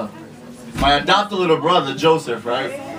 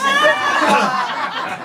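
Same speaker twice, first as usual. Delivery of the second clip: high laugh. Real, not acted. Laugh on stage.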